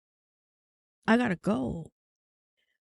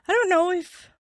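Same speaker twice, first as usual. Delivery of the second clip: voice cracking; 8 semitones higher, 5 dB louder, slower than usual. Real, not acted. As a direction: voice cracks and breaks up. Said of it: "I lost my voice".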